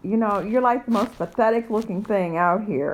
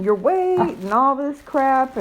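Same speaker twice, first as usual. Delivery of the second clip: ordinary delivery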